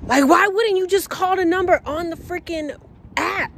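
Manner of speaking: high, loud, fed-up rant; exasperated rant. Real, not acted. Venting to viewers about the driver, high exasperated rant. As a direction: throw up your hands in disbelief.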